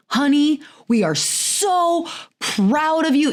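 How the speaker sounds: put-on high, slow, gushing pride for a child; gushing praise. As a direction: pour praise all over your daughter. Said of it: "love vomiting all over her".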